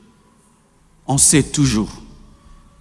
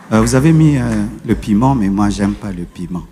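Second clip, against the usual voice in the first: gentle home voice; 7 semitones lower than usual. Shown as a demonstration. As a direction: say it kindly, no fuss. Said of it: "C'est comme ça que je parle".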